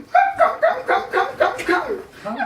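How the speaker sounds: very high hamster nibbling noises; cartoon hamster crunching snack; squeaky munching. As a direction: squeak each crumb like a chewing hamster. Hamster munching sounds voiced.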